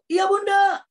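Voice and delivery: high, ringing shout back; loud shouted answer. Retold or imitated. Son answering his mother from afar, loud high shout. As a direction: yell back across a distance. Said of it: "Kenapa suara saya lantang?".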